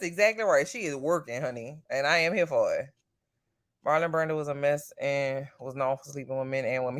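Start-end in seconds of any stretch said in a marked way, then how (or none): none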